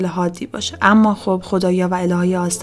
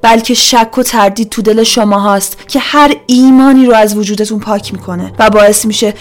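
loud, forceful oratory to a crowd; 2 semitones higher, 8 dB louder than usual. A narrator's reading. Magdalena to the silent villagers, loud accusing proclamation. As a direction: proclaim it loudly, easing off at the end. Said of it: "با صدای بلندی گفت".

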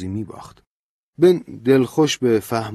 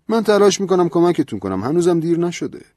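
higher, louder, insistent priest; lecturing priest; 10 semitones higher, 6 dB louder than usual. A narrator's reading. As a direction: scold him with the priest's rising fervour. Priest urges Ben.

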